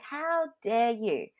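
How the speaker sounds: high, slow, indignant retold outrage; seething. To the executive who said no to her raise, slow seething indignation. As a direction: drag it out, offended to the core. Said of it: "but I was seething".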